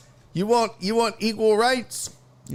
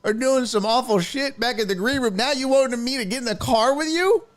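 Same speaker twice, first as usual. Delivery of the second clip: indignant rant; 7 semitones higher than usual. Retold or imitated. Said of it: "I was like".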